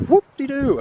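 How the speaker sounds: high, sing-song mockery; sarcastic whoop-de-doo. Real, not acted. Vlogger scoffing at the gate, high sarcastic sing-song. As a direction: sneer at obeying rules. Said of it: "mocking the rule".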